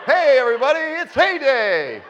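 high, loud, stretched gloating cheer; gleeful announcer shout. Acted. Preacher voicing Satan's gloating, loud and high. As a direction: crow it like a delighted showman.

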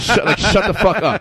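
half-laughing "shut the fuck up" at the table; laughing retort. Retold or imitated. Teen telling off classmates at lunch, laughing mock scold.

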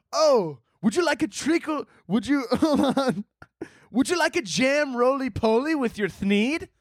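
high, put-on fancy British server voice; mock a prim British host offering dessert; posh British waiter. Mocking a dainty British host offering treacle tart.

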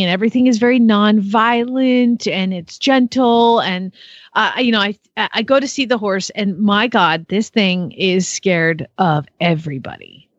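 ordinary talk, nothing marked

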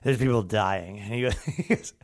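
put-on low, soft drunken mumbling; lightly voiced drunk muttering to himself. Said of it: "he's muttering to himself".

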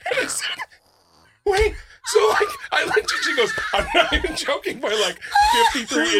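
high, loud shout cracking with laughter; loud laugh-crying panic. Real, not acted. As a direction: yell it, laughing in disbelief. Laughing panic retelling the test.

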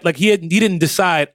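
ordinary talk

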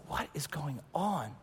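soft, bewildered mutter under the prayer; confused aside. Retold or imitated. Tony puzzled while being prayed over, softly. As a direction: murmur it baffled to yourself.

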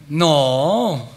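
drawn-out, mock-reluctant no; reluctant refusal. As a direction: stretch out an unwilling no. Acted reluctant refusal to God.

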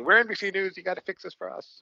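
high, loud mock demand to the vendor. IT founder quoting his demand to the vendor, high mock insistence.

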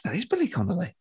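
quiet, soft aside on spotting a star; hushed recognition. Retold or imitated. Comedian to friend on spotting their hero, hushed.

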